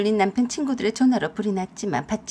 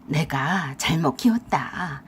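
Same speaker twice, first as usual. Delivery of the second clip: low remorseful parent; 7 semitones lower, slower than usual. A narrator's reading. In-law apologizes on phone.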